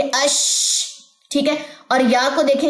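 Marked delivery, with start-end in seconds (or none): 0.0-0.9 अश with the shin hissed and spread out; shin sound. Shown as a demonstration. Teacher demonstrating shin to students, breathy spread sound. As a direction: let the air spread on अश. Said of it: "शीन को देखें".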